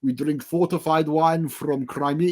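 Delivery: mock a Russian woman protesting in a bad Russian accent; awful Russian accent. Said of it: "I'm doing this most awful Russian accent".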